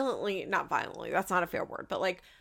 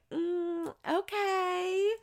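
much higher, coy, drawn-out mimicry; giddy mock squeal; 11 semitones higher, much slower than usual. Mimicking the mom delighted by the husband's answer, much higher. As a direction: coo it like a flattered girl. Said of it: "She's like".

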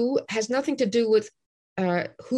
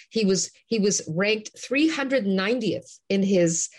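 ordinary talk

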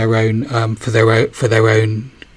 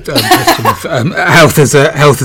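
laughing through the words; words broken by a giggle; 4 semitones higher, 7 dB louder than usual. Real, not acted. Host laughing mid-question.

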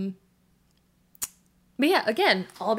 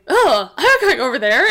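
reluctant refusal; 4 semitones higher, 12 dB louder than usual. Retold or imitated. Friend refusing, retold.

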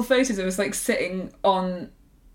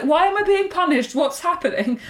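mock indignation; 7 semitones higher than usual. Retold confusion alone backstage.

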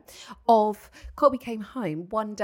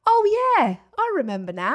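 put-on much higher, loud breezy child's oh yeah; offhand kid voice; 8 semitones higher, 7 dB louder than usual. Mum imitating her son remembering. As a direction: toss it off like a kid who suddenly remembers. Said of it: "he went".

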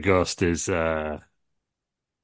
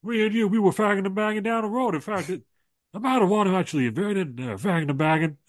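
high, loud podcast-host Stone Cold; high-pitched Stone Cold reminiscing, loud at first; Stone Cold impression; 11 semitones higher, 4 dB louder than usual. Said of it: "He said".

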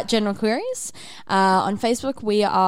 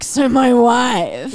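mock catchphrase; 2 semitones higher, 9 dB louder, much slower than usual. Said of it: "come back and be like".

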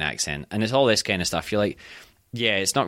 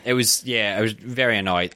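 normal talking voice

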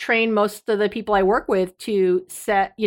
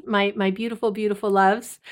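ordinary talk, nothing marked

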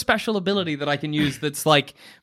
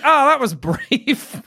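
slow, high, drawn-out dry remark; wry; 10 semitones higher, 4 dB louder, much slower than usual. Retold or imitated. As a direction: stretch the oh, deadpan irony. Dry comment on quick death.

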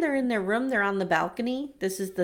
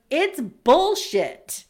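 blunt verdict; 4 semitones higher, 6 dB louder, much slower than usual. Retold or imitated. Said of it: "Olajuwon's like".

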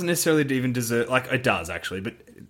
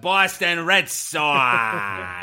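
put-on very slow, higher, over-the-top Boston accent; 4 semitones higher, much slower than usual. Podcaster mimicking an actor for the co-hosts' laughs, hammed-up Boston accent. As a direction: stretch every word in a caricature Boston accent. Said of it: "Oh, that accent".

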